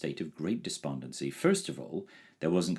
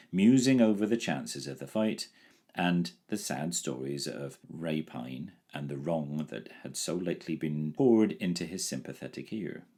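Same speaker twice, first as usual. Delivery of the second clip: ordinary delivery, nothing marked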